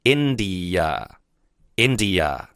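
India said slowly and clearly twice; teacher's model word. Shown as a demonstration. Teacher to students, slow careful model. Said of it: "印度英文就叫India".

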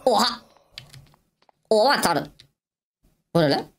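high-pitched startled exclamation. Real, not acted. Gamer startled by what he found, high startled cry. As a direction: jump in pitch with sudden shock. Sudden surprise while playing.

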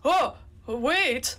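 high, loud burst of surprise; startled exclamation. Real, not acted. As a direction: call out in sudden surprise. Sudden surprise during play.

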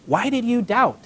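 mild reproach, read out as a quote; questioning reproach. A narrator's reading. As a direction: ask it as a gentle challenge. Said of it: "he said".

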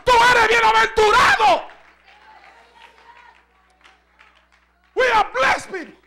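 loud, driving sermon voice; fiery preaching. Acted. Preacher drives the congregation to worship. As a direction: preach it with full force.

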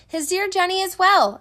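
yelling. A narrator's reading. Boston to the shuttered houses with Jenny's news, yelling. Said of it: "turned to the shuttered houses and yelled".

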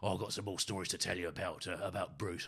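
friendly Essex bloke greeting, lightly voiced; a hint of East End Essex accent. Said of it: "he'd always say".

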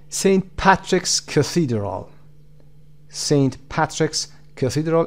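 slow "Saint Patrick's Cathedral", strong "Pat" and "the"; stress on PAT and THE. Shown as a demonstration. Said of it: "پاتریک قسمت اول".

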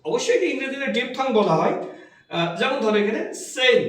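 normal talking voice

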